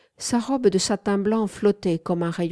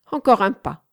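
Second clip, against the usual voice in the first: louder, firm command; 3 semitones higher, 6 dB louder than usual. A narrator's reading. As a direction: order him one step nearer. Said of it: "commanda Wanda". (ordre ferme et impérieux)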